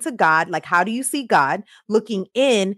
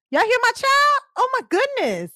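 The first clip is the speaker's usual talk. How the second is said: high-pitched surprised call to the listeners; startled exclaim. Real, not acted. Host reacts to her daughter waking up, high and surprised. Child interrupts, talks to her.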